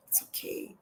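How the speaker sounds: soft whispered 'It's okay'; a gentle whisper of self-reassurance. Real, not acted. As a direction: breathe it to yourself. Reassuring herself after confession.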